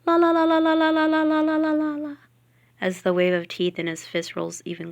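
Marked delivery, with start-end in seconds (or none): 0.0-2.2 put-on high, loud childish chanting; little boy shouting with ears covered. A narrator's reading. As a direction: drown everyone out like a stubborn little boy.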